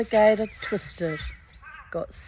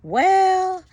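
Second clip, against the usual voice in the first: high, loud drawn-out "Well"; sly Wiley; 12 semitones higher, 7 dB louder than usual. Acted. Wiley baiting the hairy man, high drawn-out call. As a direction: lure him in with a sing-song. Wiley sets his trick.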